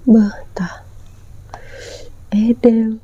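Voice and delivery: whispering. Real, not acted. Showing the food to camera.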